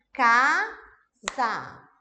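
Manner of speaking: "casa" as two loud, widely spaced beats, ca, sa; syllable by syllable. Shown as a demonstration. Example of clear gestures per syllable, "casa" in separated syllables. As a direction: punch out "casa" one syllable at a time. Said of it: "ela é formada por aqueles pedacinhos".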